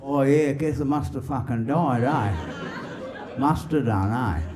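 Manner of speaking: soft-ending broad Aussie reply; newly dead soul. Acted. Soul answering God at arrival, broad Aussie voice. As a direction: answer as a bemused Aussie newcomer. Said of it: "a soul comes up to heaven".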